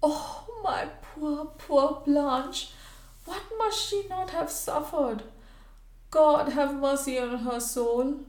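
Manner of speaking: high, anguished lament; cried between sobs. A narrator's reading. Missus Clayton grieves over poor Blanche, crying between sobs. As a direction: cry it out high, as if through sobs. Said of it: "she cried between her sobs".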